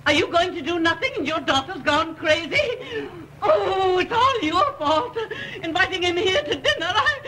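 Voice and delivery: high, wailing, tearful accusation; hysterical outburst. Acted. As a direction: blame your husband in mounting hysterics. Mother in hysterics, blaming her husband.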